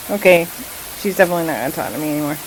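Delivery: ordinary talk, nothing marked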